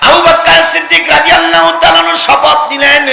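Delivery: high, loud proclamation; high-pitched sermon shout; impassioned preaching cry. A narrator's reading. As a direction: proclaim it at full force to a crowd.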